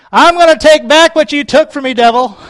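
high and loud, taking it back; defiant shout at the devil. Retold or imitated. Preacher showing how to confront the devil, loud defiant shout. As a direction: yell it right in the devil's face. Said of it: "go to him and say".

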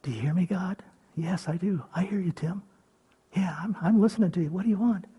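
soft unvoiced whisper; talking quietly with God; whispered prayer. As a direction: pray in a hush. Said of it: "I can even go to my God and say".

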